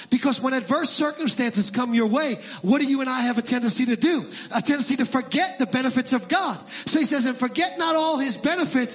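high, rising shouted preacher cadence; preaching at full force. Acted. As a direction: build to a roar against shame. Preaching climax on shame.

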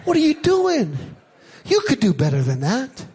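strained, high-pitched mock calm. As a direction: feign calm while the pitch swoops up and down. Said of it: "very calmly said".

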